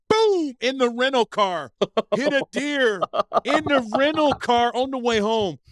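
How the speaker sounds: high-pitched, incredulous storytelling outburst; exclaimed crash retelling. Retold or imitated. Acts out hitting deer, incredulous.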